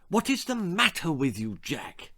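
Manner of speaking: put-on a short, mildly cross snap at the child; snapped. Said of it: "she snapped".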